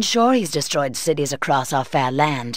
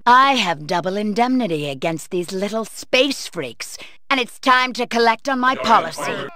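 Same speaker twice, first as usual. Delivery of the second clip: ordinary delivery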